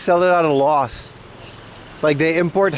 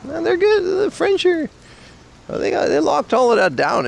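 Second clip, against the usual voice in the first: excited; 6 semitones higher than usual. Real, not acted. Excited praise of French food.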